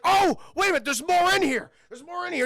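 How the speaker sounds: high, loud, eager dad; acted-out gleeful surprise; excited discovery. Dad finds more in the box.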